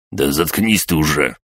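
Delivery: ordinary delivery, nothing marked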